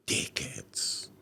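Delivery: whispered insult. Real, not acted. Speaker confides his verdict on the police, whispered insult. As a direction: drop to a voiceless whisper. Insult he expects trouble for saying.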